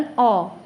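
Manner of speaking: "अ" rounded into an open aw; letter A as aw. Shown as a demonstration. Teacher shows the sound of A in ball, "अ" as rounded aw. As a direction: say "अ" like the vowel in ball. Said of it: "ए का उच्चारण".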